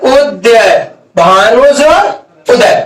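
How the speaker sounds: भानु जोर उदय spoken slowly word by word; slow dictation. Shown as a demonstration. Teacher slowly dictating the next example to students. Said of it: "कहेगा".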